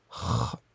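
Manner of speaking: "kha" breathed out as a rough throat kh; rasped kh. Shown as a demonstration. Teacher demonstrating the darija letter, rasped kh. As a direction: scrape "kha" from the back of the throat, no voice. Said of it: "on la prononce kha".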